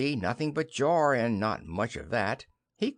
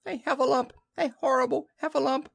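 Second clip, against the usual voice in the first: high, panicked little squeal; squeaky storybook terror; Piglet crying out; 13 semitones higher, faster than usual. Acted. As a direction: raise the alarm in a tiny piglet voice. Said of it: "cried Piglet".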